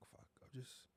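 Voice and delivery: hushed retelling. Retold or imitated. Friend retelling the hushed call, whispered. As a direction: whisper it like confessing on the phone at night.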